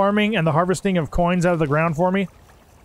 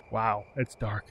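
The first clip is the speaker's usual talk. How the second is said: low, hushed scared voice; spooky whisper; 10 semitones lower, 11 dB quieter, slower than usual. Pretend. Voicing a villager at night, low hushed and scared.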